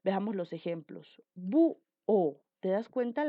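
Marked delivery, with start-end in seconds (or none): 1.3-2.4 "Bu-o" with a loud BU, then separate o; stress on U. Shown as a demonstration. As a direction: punch the U of "Bu-o", pause before o. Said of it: "La mayor intensidad de voz está en la U".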